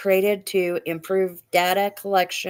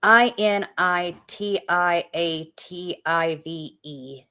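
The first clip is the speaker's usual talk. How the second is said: slow, letter by letter; spelled out; slower than usual. Shown as a demonstration. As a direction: spell each letter slowly. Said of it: "spelling the word out letter by letter".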